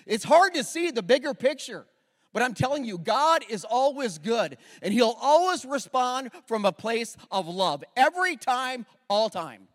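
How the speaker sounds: high, loud, impassioned preaching; preaching at full force. Acted. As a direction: preach it out with full fire to the crowd.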